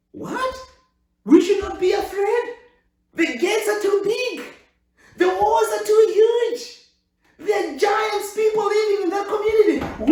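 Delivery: high, loud, incredulous protest; hammed-up incredulous outcry; mock disbelief. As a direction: act shocked at the idea.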